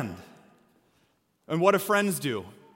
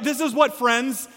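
ordinary talk, nothing marked

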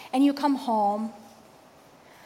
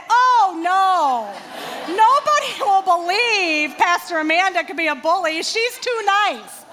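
higher, louder, gushing mock protest; mock gushing admirer; 6 semitones higher, 6 dB louder, slower than usual. Pastor imagines colleagues defending her niceness, gushing mock protest. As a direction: gush it like a shocked fan defending her. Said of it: "nobody said".

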